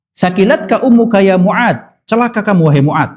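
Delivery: "Sakilatka ummuka ya Mu'ad" exclaimed as a fond scolding; mock rebuke. Preacher voices the Prophet gently scolding Mu'ad, a mock rebuke. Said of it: "kayak minta ampun buat Mu'ad".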